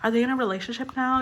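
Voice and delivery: nosy speculating fan, lightly voiced; a hint of excited tabloid gossip; gossipy fan voice.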